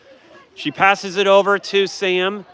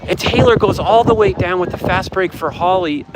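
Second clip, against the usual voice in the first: ordinary talk, nothing marked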